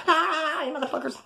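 high yell sinking into a mutter; imitating mom yelling. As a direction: echo mom's garage outburst. Mom screaming in garage.

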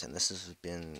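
ordinary delivery

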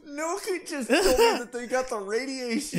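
talking through laughter; can't stop laughing while telling it; laughing while retelling. Real, not acted.

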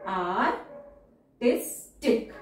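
Artistic drawn out very slowly, syllable by syllable; slow teacher dictation. Shown as a demonstration. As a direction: spell out artistic slowly for the class. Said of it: "teacher says word then dictates".

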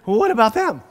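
high, loud, startled protest; alarmed question. Retold or imitated. Pastor told to preach to prisoners alone, alarmed and high. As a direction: caught off guard, pushing back in alarm.

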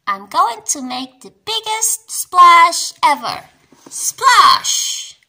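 loud, high storybook boast; proud boast. A narrator's reading. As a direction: build proudly to a big high shout. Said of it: "says Peppa proudly".

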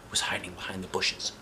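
hushed suspense whisper. A narrator's reading. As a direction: lean in and whisper the secret.

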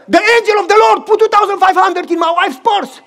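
high, loud and laughing with joy; retelling a friend's overjoyed cry; overjoyed exclaiming. Retold or imitated. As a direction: burst out with amazed delight. Friend's joyful news of the money.

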